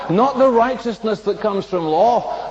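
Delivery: normal talking voice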